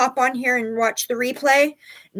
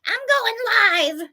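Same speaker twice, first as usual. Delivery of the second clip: mock defiant shout; 12 semitones higher, 5 dB louder than usual. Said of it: "I'm like".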